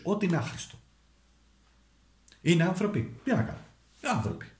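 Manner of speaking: ordinary delivery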